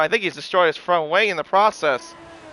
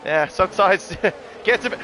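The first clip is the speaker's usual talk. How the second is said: ordinary talk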